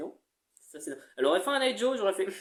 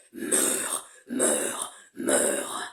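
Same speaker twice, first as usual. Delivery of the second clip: very low, unvoiced death rasp; Faucheur voice; 3 dB quieter, much slower than usual. Shown as a demonstration. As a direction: rasp out death threats slowly. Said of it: "Faucheur, ça fait".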